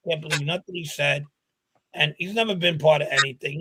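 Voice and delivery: ordinary talk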